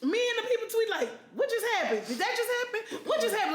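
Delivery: high, laughing disbelief; shocked excitement. Retold or imitated. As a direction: retell the chaos breathlessly. Retelling shocked Twitter reactions.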